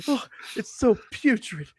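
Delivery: very high, hammed-up revulsion; mock disgust. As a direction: imitate a diva appalled by a stench. Acting out her disgust at a smell.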